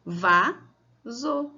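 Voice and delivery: "Vaso" in two separated syllables. Shown as a demonstration. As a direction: pause between the halves of "Vaso". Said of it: "então são duas sílabas".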